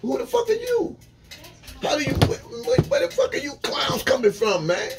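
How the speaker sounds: very high-pitched, incredulous challenge; squeaky-high outraged questioning; confronting intruders. Retold or imitated. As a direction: demand to know who they are, voice shot way up. Acting out challenging uninvited strangers.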